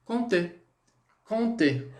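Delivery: "Conter" said as kon-TAY, silent p, nasal on; french pronunciation. Shown as a demonstration. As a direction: demonstrate "Conter" clearly with no p sound, ending in é. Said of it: "como que a gente fala isso aqui". ("Conter" pronunciado com o p mudo, som de kõ-tê)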